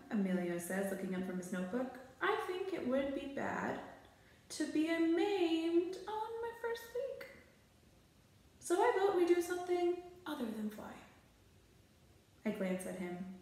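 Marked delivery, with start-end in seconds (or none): 2.2-10.9 a nervous intern's voice, pitched higher; worried sidekick, slowing on the scary part; a lightly voiced jittery assistant. Reader voices the nervous intern Emilio.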